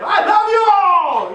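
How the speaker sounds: high, drawn-out, full-volume declaration; shouting. Acted. Showing how not to tell someone you love them, shouting. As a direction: bellow your love, stretching the words out. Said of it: "You don't shout at them".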